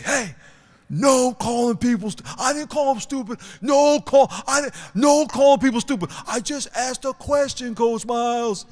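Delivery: put-on high, loud comic back-and-forth of coach and kid; acted scolding argument.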